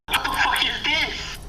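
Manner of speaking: high, slow, bewildered cry; astonished. Acted. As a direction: draw it out in mock shock. Tail beasts acted, astonished.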